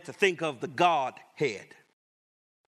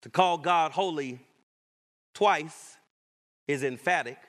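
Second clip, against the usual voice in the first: normal talking voice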